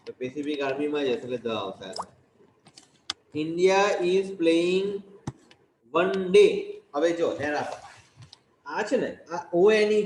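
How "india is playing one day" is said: very slow and louder, word by word; loud slow dictation. Shown as a demonstration. Teacher dictating to the class, slow and loud. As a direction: spell it out slowly for the students to write. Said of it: "teacher dictating sentence, loudly".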